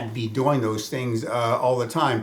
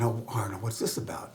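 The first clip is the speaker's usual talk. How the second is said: hushed doubt; 4 semitones lower, 11 dB quieter than usual. Retold or imitated. Wary inner thought.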